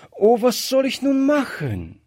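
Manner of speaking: very high-pitched, helpless lament; despairing wail. A narrator's reading. Gretchen, at a loss before the maid, despairing. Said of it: "rief Gretchen verzweifelt".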